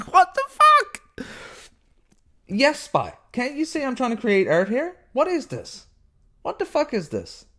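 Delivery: high shriek, then high scolding to the cat; startled at the cat. Real, not acted. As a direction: squeal at the cat, then chide it. Reacting to cat Spy interrupting.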